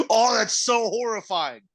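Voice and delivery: high, slow mock horror; mock horrified. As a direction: fake disgust, drawn out. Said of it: "Just be like".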